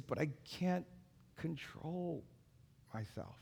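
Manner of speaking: fading to a hush, imitating tears; mild tearful quote. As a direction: softly retell a crying child. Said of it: "he's just in tears".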